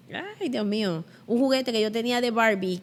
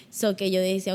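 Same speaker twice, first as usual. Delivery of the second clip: usual speaking voice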